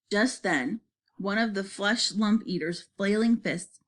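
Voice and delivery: ordinary delivery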